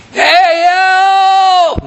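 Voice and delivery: a loud, high shout for help; calling out. Pretend. Man overboard calling to the departing ship, loud and high. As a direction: yell after the ship.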